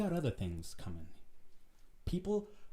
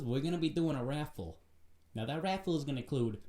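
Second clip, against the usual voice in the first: usual speaking voice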